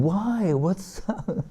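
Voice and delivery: high, soft mock plea; playful retold pleading, light and high. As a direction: imitate a boy pleading, voice jumping up high. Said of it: "And then he's pleading".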